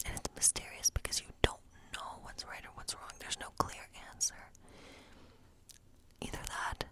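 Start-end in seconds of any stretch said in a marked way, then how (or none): none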